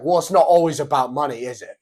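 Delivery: smug British tough guy; mock Andrew Tate British jab. Andrew Tate taunting Ashton about money, loud British jab. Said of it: "He's like".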